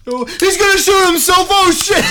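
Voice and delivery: high screaming panic; panicked shout. Acted. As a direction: scream in alarm. Reacting to mock shooting.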